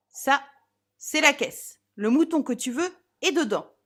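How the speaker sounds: loud, curt and exasperated; impatient. Acted. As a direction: toss it off impatiently. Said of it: "et je lançai".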